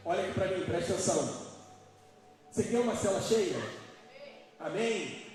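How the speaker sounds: loud, high, driving sermon voice; full-force preaching. Acted. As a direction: pastor preaching hard to the congregation.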